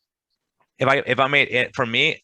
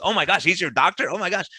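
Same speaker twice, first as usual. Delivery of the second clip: amazed; 9 semitones higher than usual. Retold or imitated. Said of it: "And he goes".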